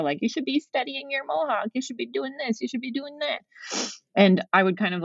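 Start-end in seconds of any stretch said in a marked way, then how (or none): 0.2-3.4 high-pitched mock parental nagging; snarky parent. Guest imitating parents nagging about studying Mohawk, snarky. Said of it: "maybe a snarky comment here and there".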